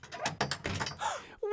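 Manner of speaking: big breathy gasp of delight; excited gasp. Acted. Little Princess waking, a thrilled gasp. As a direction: gasp awake with joy.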